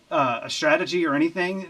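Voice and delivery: normal talking voice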